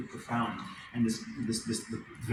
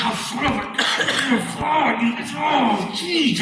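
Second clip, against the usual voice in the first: exasperated groaning; 13 semitones higher, slower than usual. Retold or imitated. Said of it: "it's like".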